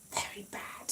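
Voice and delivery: whispered aside; hushed self-scolding. Acted. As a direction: say it under the breath to yourself. Self-mocking aside about childhood.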